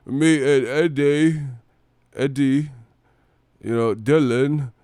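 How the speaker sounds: slow, drawn-out Forrest Gump drawl; loud, stretched-out mock Southern simpleton voice; [name] as Forrest Gump. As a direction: imitate a boxer talking like Forrest Gump.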